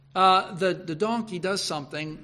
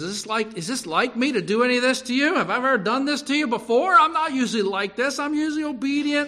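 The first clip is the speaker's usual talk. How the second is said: pleading donkey; 6 semitones higher, 4 dB louder than usual. Acted.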